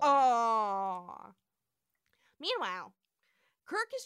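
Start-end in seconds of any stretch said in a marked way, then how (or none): 0.0-1.3 loud drawn-out "aww"; long cooing aww. Real, not acted. Cohost echoing the aww at the kiss, cooing. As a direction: join in with a big, loud coo.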